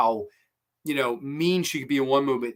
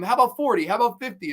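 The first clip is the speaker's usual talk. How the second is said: higher-pitched, retold pleading offers; mock begging; 8 semitones higher, faster than usual. Kidnappers beg the orderly to sell, pleading. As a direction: bargain upward, imitating eager buyers. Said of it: "they're begging him".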